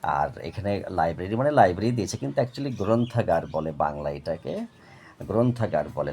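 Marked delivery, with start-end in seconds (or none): none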